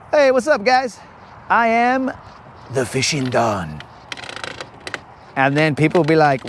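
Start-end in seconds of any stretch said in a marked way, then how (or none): 0.1-2.1 high-pitched mock greeting; upbeat self-introduction. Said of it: "I'd be all like". 2.7-3.8 low mafia-boss voice; Italian mobster. Acted. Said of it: "do it in that voice".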